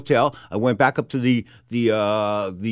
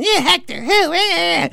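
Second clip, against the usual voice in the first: high-pitched mock gushing woman; melting ex-wife; slower than usual. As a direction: squeal like a smitten ex-wife on the phone. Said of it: "she sort of melts on the phone".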